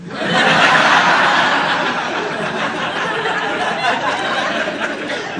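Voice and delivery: wheezing high laugh; high breathy laugh. Real, not acted. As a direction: crack up at the punchline. Big laugh at own joke.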